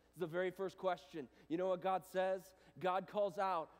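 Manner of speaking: fervent sermon build; preaching intensely. Real, not acted. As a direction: drive it home from the pulpit. Preaching at full force.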